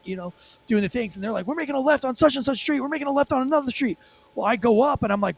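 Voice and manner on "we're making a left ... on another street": higher-pitched cop calling out turns; imitated radio callout. Officer relaying the chase over radio, imitated urgent callout. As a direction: raise the pitch as if relaying a pursuit.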